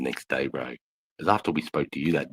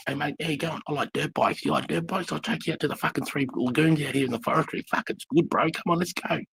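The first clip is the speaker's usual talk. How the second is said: much higher, eager mate-voice impression; imitating Dale, eager; 10 semitones higher than usual. Mimicking Dale inviting him out on dirt bikes, high and eager. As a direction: pitch it way up like an excited bloke inviting a new mate. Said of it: "Whereas Dale just".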